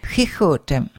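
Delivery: "chichotem" with soft throaty h sounds; soft throat x. Shown as a demonstration. Teacher modelling the letter X for learners, soft h. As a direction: breathe the h softly at the back of the throat. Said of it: "a soft H in the back of your throat".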